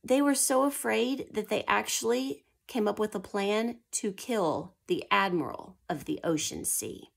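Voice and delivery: ordinary talk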